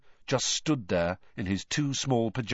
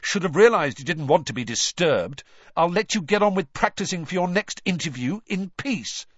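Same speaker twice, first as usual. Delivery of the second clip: angry; 7 semitones higher, 4 dB louder than usual. Acted. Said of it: "his face reddening with anger".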